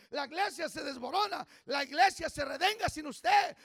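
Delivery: loud, rising sermon voice; fiery preaching. Acted. Pastor urging the congregation, fervent preaching. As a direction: drive the point home to the church.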